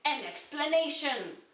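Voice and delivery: plain reading voice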